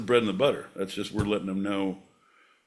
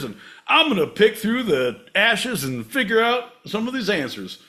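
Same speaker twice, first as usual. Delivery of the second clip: high, loud cartoonish show intro; big swooping mock panic into a hype pitch; hammed-up segment announcer; 12 semitones higher, 9 dB louder than usual. Host launching the garbage pail conundrum, hammed-up announcer.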